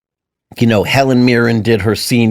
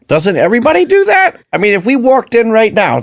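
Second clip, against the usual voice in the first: high indignant question; 9 semitones higher than usual. Real, not acted. Asking his co-host, pitched far up in disbelief. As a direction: squeak it out in disbelief.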